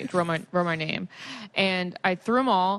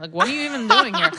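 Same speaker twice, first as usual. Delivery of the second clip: put-on higher, louder, fast drunk outburst; drunk shout; 6 semitones higher, 6 dB louder, faster than usual. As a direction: hammer the question, tipsy and loud. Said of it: "I go, like".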